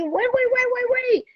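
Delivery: high-pitched, frantic calling after someone; mock urgent plea. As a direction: imitate someone desperate to stop a person leaving. Imitates him calling after her.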